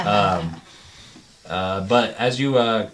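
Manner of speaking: ordinary delivery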